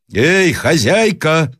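high, drawn-out hail; shouting. A narrator's reading. Traveller hails the mistress of the house, shouting. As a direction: call out from beyond the window. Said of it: "закричал опять проезжий".

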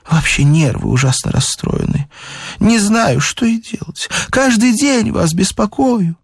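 high-pitched fretting over her nerves; complaining lady. A narrator's reading. Lady tells the doctor her nerves are upset, fretting.

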